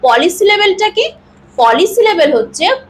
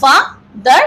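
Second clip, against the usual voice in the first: father as fa, short pause, dar; two distinct beats on father; split into two syllables; 3 semitones lower than usual. Shown as a demonstration. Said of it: "আমরা দুটো সিলেবেলে উচ্চারণ করব". (father শব্দটা দুই সিলেবলে আলাদা করে)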